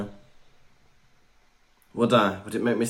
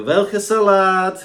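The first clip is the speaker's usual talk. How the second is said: German server; 5 semitones higher, 6 dB louder, much slower than usual. Retold or imitated. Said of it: "they'll go".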